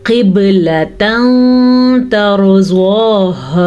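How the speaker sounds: slow chanted tajweed recitation; Quranic recitation. Shown as a demonstration. Teacher reciting slowly to students. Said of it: "बा पे झटका करना लाजमी है".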